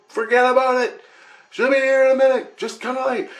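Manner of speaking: high dismissive calling voice; imitated voices calling back. Family in the light answers the husband, high imitated calling. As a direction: call back down in a high pitch. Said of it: "yelling at me".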